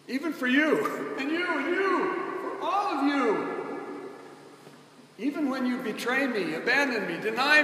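building preacher's call; emphatic. Real, not acted. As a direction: reach out to every person in the room. Preacher pointing at each listener.

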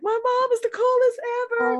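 very high fangirl squeal; imitated gushing. Daughter bragging about mom on social media, imitated high-pitched gushing. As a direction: gush it up high like an excited daughter.

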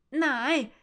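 loud high cry of no; shout. Acted. Sister protesting unfair treatment, shouting. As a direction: yell it out, outraged. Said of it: "skriker hun".

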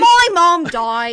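slow loud put-on British accent. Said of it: "doing British accents".

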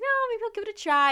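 high, quick, loud self-persuasion; mock optimism. Vlogger talking herself into a dating app, mock optimism. Retelling her own change of mind.

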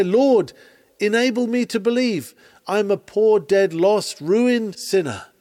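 high, loud plea of a sinner to God; pleading cry. Acted. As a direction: cry it out as a desperate prayer.

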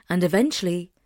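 ordinary delivery, nothing marked

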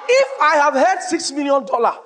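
loud, high and drawn out; preaching shout. Real, not acted. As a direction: proclaim it slowly at full voice. Preacher exclaims to congregation.

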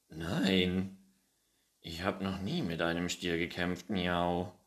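alarmed cat voice, miaowing at the end; startled cat. Acted. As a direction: blurt it out like a frightened little cat. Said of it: "antwortete Findus erschrocken".

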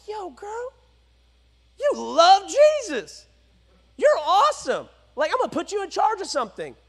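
high, gushing mock praise; lightly voiced hype friend; flattering buddy. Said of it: "I would have been like".

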